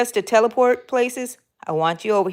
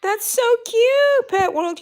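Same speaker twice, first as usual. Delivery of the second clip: much higher, slowed cooing; gushing cooing. Real, not acted. Gushing over a viewer's photo.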